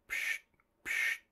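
two soft voiceless pshes; hushed puffs of sleeping breath; very quiet "psh", twice; imitated snoring puffs. A narrator's reading. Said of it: "it went".

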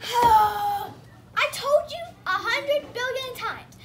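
slow, loud, tearful shout; angry shout. Acted. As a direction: yell it out, upset and fed up. Max angry at nickname.

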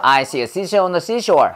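rapid tongue twister run-through; quick-fire S drill in one breath; really fast. Shown as a demonstration. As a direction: rattle off "I see a seashell on the seashore". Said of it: "진짜 빨리 해봐".

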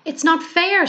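put-on high sulky child's complaint; childlike whine. A narrator's reading. Bear sulks to the owl, high childlike whine. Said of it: "like a child with no toys".

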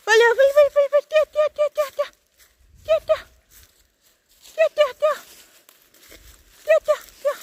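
high, excited run-run chant; excited urging. Real, not acted.

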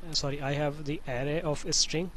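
ordinary delivery

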